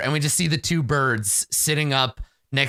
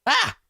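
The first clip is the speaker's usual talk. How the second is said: high startled gasp "Ah!"; short mock yelp of fright. Creature jumping as someone appears beside him, startled yelp. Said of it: "like, s-, startled".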